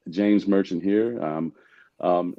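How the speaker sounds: ordinary talk, nothing marked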